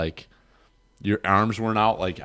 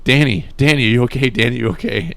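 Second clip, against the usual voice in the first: loud, raised calls of his name; urgent calling out; 4 semitones higher, 7 dB louder than usual. Retold or imitated. Friend shouting to a knocked-out buddy in the snow, loud and urgent. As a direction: call him back like he won't wake.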